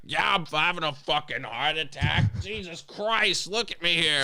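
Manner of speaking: high, strained mock dying trucker; mock panicked trucker. Trucker yelling at clerk during heart attack, high and strained.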